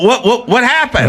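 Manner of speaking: high, baffled what happened; mock bewildered teammate asking; confused teammate. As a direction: teammate baffled after the play. Teammates confused after play.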